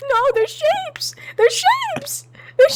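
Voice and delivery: high laughing insistence. Real, not acted.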